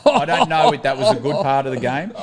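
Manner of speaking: chuckles his way through the line; amused, breaking into a laugh. Real, not acted. Admitting to the host he's unsure it was fair, laughing.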